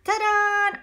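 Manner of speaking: high, loud showman's flourish; high, loud ta-da like a circus ringmaster; showy ta-da. Pretend. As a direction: present the act with a flourish. Child presents the circus act.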